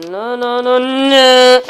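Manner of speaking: repeated no growing into a shout; building frustrated wail. Real, not acted. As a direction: swell it into a long yell.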